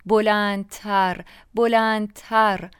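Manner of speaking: slow, drawn-out repeats, each stronger; building emphasis. A narrator's reading. Narrator recalls turning the singer's music up, building emphasis. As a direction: stretch بلندتر out slowly, raising the force each time. Music turned up ever louder.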